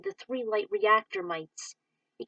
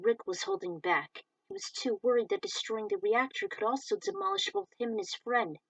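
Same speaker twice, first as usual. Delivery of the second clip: ordinary delivery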